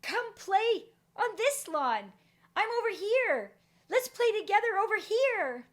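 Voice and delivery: put-on high, loud child calling across the lawn; excited shouting. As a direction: call out eagerly to the kids over there. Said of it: "So I excitedly am shouting over".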